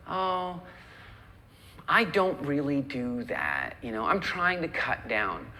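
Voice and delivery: wavering, drawn-out unconvincing excuse; unsure and non-committal; hesitant refusal. Acted. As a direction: hedge slowly, as if open to persuasion. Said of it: "you just sounded like".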